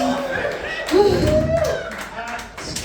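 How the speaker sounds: long high sigh of frustration; exasperated sigh. Real, not acted.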